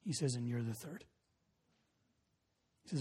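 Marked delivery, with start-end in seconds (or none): 0.3-1.0 low, hushed disclosure; grave revelation. Retold or imitated. Suicidal man telling the youth pastor, hushed and grave. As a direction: let it land softly and low.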